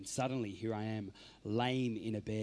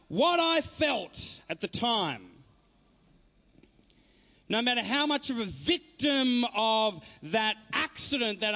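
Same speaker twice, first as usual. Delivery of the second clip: higher, louder, building inspirational address; rousing motivational preaching; 13 semitones higher, 5 dB louder than usual. Acted. Speaker rallies audience through their struggles, rising preacher-like fervor. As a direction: lift the crowd, pressing each question home.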